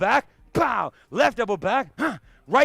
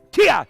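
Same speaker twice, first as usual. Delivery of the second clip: sharp, loud, higher martial arts kiai; kiai shout; 4 semitones higher, 9 dB louder than usual. Shown as a demonstration. Instructor showing the class a strike: loud kiai. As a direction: explode the kiai as you strike. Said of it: "Make it fast and hard".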